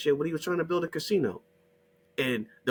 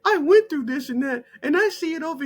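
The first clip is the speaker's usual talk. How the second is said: high, louder mocking whine; 11 semitones higher, 5 dB louder than usual. Mocking newcomers boasting of hardship, high and loud. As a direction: parody a self-pitying claim. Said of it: "mocking immigrants' claims".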